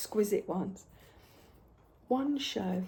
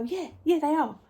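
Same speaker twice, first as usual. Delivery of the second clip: breezy loud agreement; 6 semitones higher, 6 dB louder than usual. Retold or imitated. Agreeing cheerfully with complaining customers.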